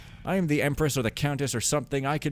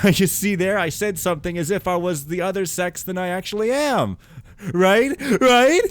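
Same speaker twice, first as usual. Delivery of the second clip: laughing; 9 semitones higher, 8 dB louder than usual. Real, not acted. Laughing at own joke.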